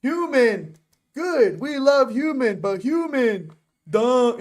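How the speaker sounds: lightly voiced gorilla speaking in simple words; Koko the gorilla. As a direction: act a gorilla making blunt pronouncements. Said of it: "I feel like Coco the gorilla".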